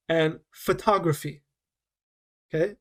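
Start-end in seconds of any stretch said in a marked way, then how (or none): 0.5-1.4 "photography" with the second syllable TOG stressed. Shown as a demonstration. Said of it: "we're stressing different parts of this word".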